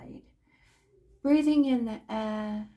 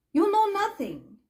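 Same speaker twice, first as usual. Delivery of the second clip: high, louder and fast put-down; scolding; 10 semitones higher, 4 dB louder, faster than usual. Acted. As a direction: snap at the child for not keeping up. Scolding the impertinent child.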